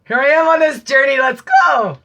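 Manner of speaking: high, loud triumphant shout; excited call. Acted. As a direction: shout it out like setting off on a quest. Calling out starting journey.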